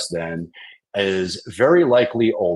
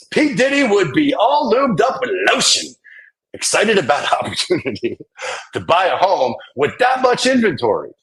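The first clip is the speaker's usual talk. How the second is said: hyped joke voice; 10 semitones higher than usual. Pretend. Hyped joke about [name].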